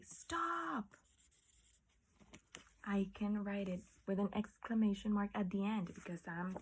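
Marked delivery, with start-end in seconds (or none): 0.0-1.0 high whispered command; commanding stop. Shown as a demonstration. Teacher demonstrating the exclamation to students, whispered high. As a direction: hiss the order up high. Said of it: "if I say".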